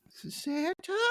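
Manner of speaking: put-on high, soft hopeful little girl asking; Lisa. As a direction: lift it up like a small girl full of hope. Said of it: "you hear Lisa going".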